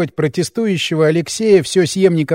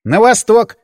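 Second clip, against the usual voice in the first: louder, higher, blurted; 6 semitones higher, 5 dB louder than usual. A narrator's reading. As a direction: fire it off without thinking. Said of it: "выпалил я".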